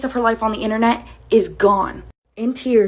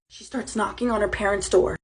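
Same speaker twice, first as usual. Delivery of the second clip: normal talking voice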